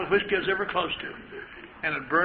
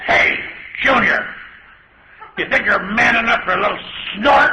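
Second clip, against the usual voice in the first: loud, higher teasing dare, laughing through it; sailor taunting; 5 semitones higher, 10 dB louder than usual. Retold or imitated. Sailor daring the sixteen-year-old to drink, loud taunting call. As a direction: call out the dare with a mocking grin. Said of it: "the guy turned to me and says".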